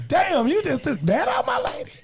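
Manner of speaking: high, shouted panic; panicked thoughts. Retold or imitated. Comedian replays his panicked inner voice, high and shouting. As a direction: freak out at the sight. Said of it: "I'm thinking like".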